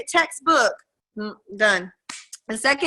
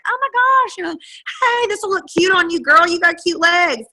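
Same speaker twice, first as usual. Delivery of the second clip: put-on much higher, louder girly gush; chatty store clerk; 7 semitones higher, 3 dB louder than usual. Clerk at Buckle complimenting the husband, high girly gush. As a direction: gush like a bubbly salesgirl. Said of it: "and the girl was like".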